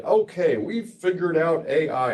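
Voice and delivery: high, put-on made-up accent for Epic; mock corporate voice in a made-up accent. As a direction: spoof the company in an invented accent. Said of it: "I don't know why I'm doing that accent".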